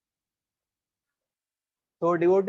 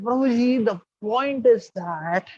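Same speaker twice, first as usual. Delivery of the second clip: high, slow drawn-out start; hesitant student; 6 semitones higher, 4 dB louder, much slower than usual. Retold or imitated. Shy student hesitating to tell the monk his problem, drawn-out. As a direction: stall before the confession.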